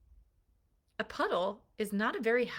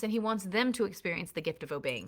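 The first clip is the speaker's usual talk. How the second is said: ordinary delivery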